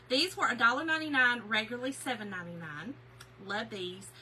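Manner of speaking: normal talking voice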